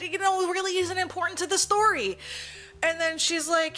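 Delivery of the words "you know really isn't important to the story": hammed-up protest. Boyfriend explaining he was working, mock-indignant protest. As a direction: protest it loudly, stretching the first words. Boyfriend's excuse, acted with parody.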